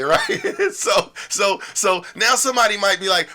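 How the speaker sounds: ordinary delivery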